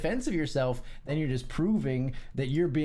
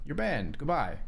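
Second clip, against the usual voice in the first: ordinary talk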